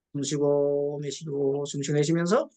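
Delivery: slow, low breathing guidance; unhurried demo of breathing in and out. Shown as a demonstration. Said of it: "호흡을 하라고 했어". (호흡을 천천히 안내하듯)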